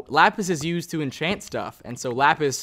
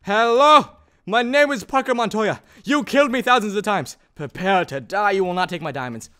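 high, louder hammed-up duelist challenge; mock vengeance vow; 5 semitones higher, 5 dB louder than usual. Player parodies a duel vow defending diamonds. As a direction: declaim the revenge vow at a creeper. Inigo Montoya parody third time.

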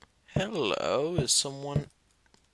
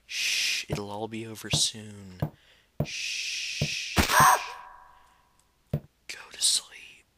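slow, whispery killer lullaby; creepy soothing hush; 5 semitones lower, slower than usual. Pretend. Mock soothing killer while stabbing.

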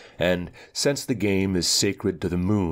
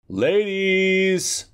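calling loudly through the door, pitched high; shout; 4 dB louder than usual. Acted. Cousin bangs on the door and calls the ladies, loud shout. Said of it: "shouted the cousin".

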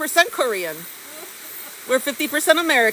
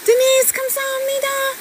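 high cute voice; 11 semitones higher, much faster than usual. Acted. High fast thank-you.

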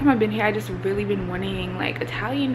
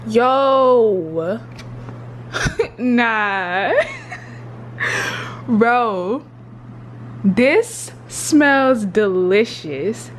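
loud, slow food rapture; laughing, louder food delight; excited; 3 dB louder, much slower than usual. Real, not acted. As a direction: lose it over the smell. Overwhelmed by food smell.

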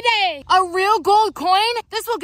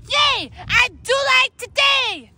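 excited shout; 6 semitones higher, 4 dB louder, slower than usual. Acted. Delighted by candy canes.

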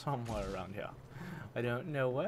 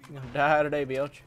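louder, punchier taunt; 8 dB louder than usual. Acted. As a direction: yell the threat at the enemy. Taunting enemy in game.

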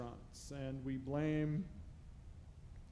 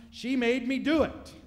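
high, loud indignant excuse; whiny Adam blaming; 10 semitones higher, 13 dB louder than usual. Acted. Adam to God in the garden, loud petulant excuse. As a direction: pass the blame in a petulant cry.

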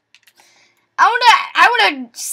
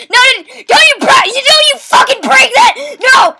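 loud, high, furious no; angry yelling; 7 semitones higher, 5 dB louder than usual. Real, not acted. Gamer yelling at a troll. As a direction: yell to stop him breaking it. Yelling at player breaking things.

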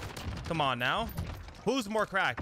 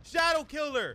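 sudden yell; 11 semitones higher, 3 dB louder, much slower than usual. Real, not acted. Sudden kill by enemy player.

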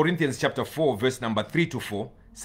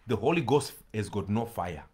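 ordinary delivery

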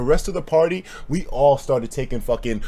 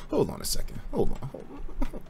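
hushed; 7 semitones higher, 11 dB quieter than usual. Real, not acted. Reacting to the chat question.

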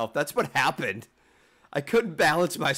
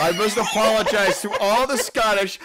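laughing apology; 8 semitones higher, 7 dB louder than usual. Real, not acted. Laughing after bad accent.

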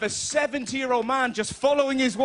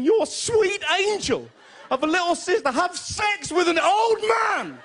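rising comic outrage; 8 semitones higher, slower than usual. Real, not acted. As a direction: build it up in laughing disbelief. Horrified recounting.

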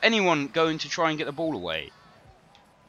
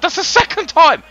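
high, loud outburst of disbelief; outraged shout; 13 semitones higher, 13 dB louder than usual. Real, not acted. Streamer seeing his striker hit the post, outraged shout. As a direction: bellow it at the screen. First take of the repeat.

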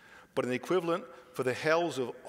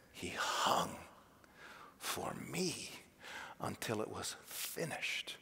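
breathy hush; 3 semitones lower, 9 dB quieter than usual. Real, not acted. Preacher breathes the crucifixion softly.

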